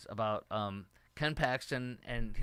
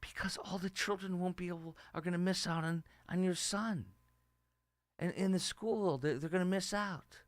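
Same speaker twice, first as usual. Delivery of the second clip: a mocking, pitying take on the reporter; hammed-up concerned voice, pitched up; 7 semitones higher than usual. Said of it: "He said".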